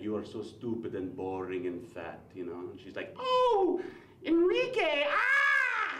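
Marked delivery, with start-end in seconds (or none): 0.0-2.2 put-on low, soft Spanish-accented seducer; smooth mock Spanish lover accent. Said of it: "what the Spanish guy says". 3.2-5.9 very high, loud mock moans of pleasure; hammed-up ecstatic female lover; swooning woman. Swooning woman answers Spanish lover.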